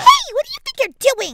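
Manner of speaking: high, loud indignant shout; loud challenge. Acted. Fangirl catches him fighting, shouting at him. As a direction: yell it at the intruder.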